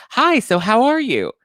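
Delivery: very high, loud eager greeting; sing-song high hello to a stranger; overly bright hello. Retold or imitated. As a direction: chirp it out sky-high and friendly. Josh greets girl very eagerly.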